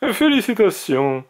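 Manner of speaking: put-on high, enthusiastic old mage; bright-eyed enthusiasm, pitched well up; enthusiastic congratulations. A narrator's reading. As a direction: congratulate them with real enthusiasm. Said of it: "dit le grand mage avec enthousiasme".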